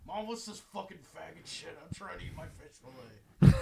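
put-on rough kid yelling at mom; gruff dock worker hollering. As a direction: holler like a foul-mouthed dockhand. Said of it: "Talk like a dock worker at seven".

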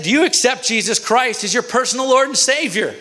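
high-pitched, acted evangelizing demand; urgent demand. Boy atop the bully demands conversion, acted urgent demand. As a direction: deliver the question high, as an ultimatum.

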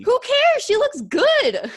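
very high, loud, emphatic defence; loud, high, drawn-out protest; indignant exclamation. Real, not acted. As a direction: shout it in playful outrage. Indignant defence of actress's looks.

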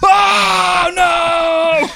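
high, long drawn-out cry of horror; hammed-up demon howl of dismay; demon's mock wail. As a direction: wail it high, stretching each word. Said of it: "and you hear him go".

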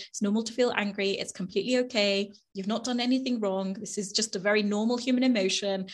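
soft, reassuring murmur to yourself; soothing self-talk. Retold or imitated. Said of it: "put my hand on my chest and say".

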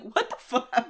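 mock exasperated outburst; pissed off. King baffled by the cardinal's stupidity, mock angry. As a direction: imitate baffled anger. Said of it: "not even mad about".

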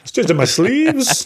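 lightly voiced, high and slow; a playful high sing-song; high slow magician voice. Joking magician line.

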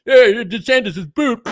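high, loud dopey chant; mock rally chant; mock cheering fan. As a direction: ham up a brainless superfan. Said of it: "That's like".

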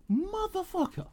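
high, soft, drawn-out curse; mock exasperation. Reacting to the easy confession, mock exasperated. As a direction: stretch it high in disbelief. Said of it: "I was like".

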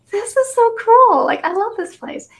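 high, loud gushing delight. Retold or imitated. Retold delight in deli.

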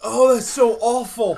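slow, loud groan of burning pain; mouth burning from spicy food. Real, not acted. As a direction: moan through a scorched throat. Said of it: "Everything about my mouth and my throat hurts".

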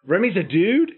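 high, loud disbelief; shocked double-take question; surprised. Real, not acted. Surprised discovery.

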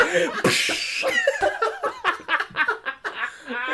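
high laugh. Real, not acted. Laughing about battle.

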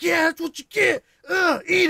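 loud, high triumphant taunting; re-enacted competitive gaming shout; gamer trash-talk yell. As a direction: shout it at the enemy you just beat. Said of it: "I'm killing people like".

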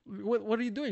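baffled, put-out challenge; indignant retort. Retold or imitated. Comic challenges Ren for leaving mid-answer, indignant. Retold complaint to friend.

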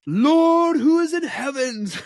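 high, loud, hammed-up televangelist cry; over-the-top stage preacher voice; mock prosperity preacher. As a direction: parody a showy faith healer calling on God. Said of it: "He wants what modern day prosperity gospel teachers do".

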